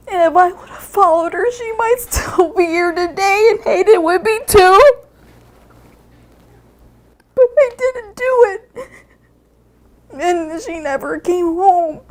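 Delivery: high, tearful voice breaking into sobs; grief-stricken, high-pitched crying; real crying. Real, not acted. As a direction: choke it out slowly through real tears. Sister breaks down in regret.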